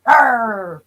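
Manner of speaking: playful "arr" growl; mock tough growl. Streamer defending a rough and tough persona, mock growling. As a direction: growl to fake a tough exterior.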